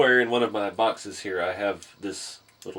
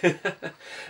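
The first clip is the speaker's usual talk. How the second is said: short laugh; 8 semitones higher than usual. Real, not acted. Laugh after the plug.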